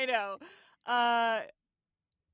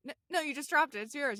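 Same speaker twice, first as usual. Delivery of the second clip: insistent quote; 6 semitones higher, 6 dB louder than usual. Retold or imitated. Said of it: "He's like".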